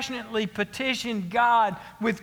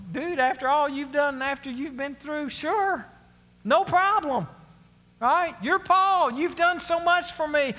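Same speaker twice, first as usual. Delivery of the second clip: higher, breezy God reassuring Paul; casual God voice; 5 semitones higher than usual. Retold or imitated. God casually promising Paul whatever he needs, higher and breezy. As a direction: play God as an easygoing buddy. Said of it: "if I'm God, I'm going".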